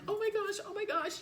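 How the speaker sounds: breathless panic, over and over; panicked. Retold or imitated. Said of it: "And I was like".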